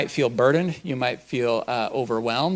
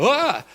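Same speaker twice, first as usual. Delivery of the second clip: sudden high yelp of a spooked sheep; startled sheep cry; 12 semitones higher, 4 dB louder than usual. Retold or imitated. Sheep spooked by a noise, sudden high yelp. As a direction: jump out of your skin with a short high "Ah!". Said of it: "they go".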